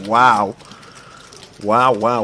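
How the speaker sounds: loud, amazed wows. Real, not acted. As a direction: react loudly to a big raise. Big raise on the table.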